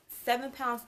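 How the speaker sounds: ordinary talk, nothing marked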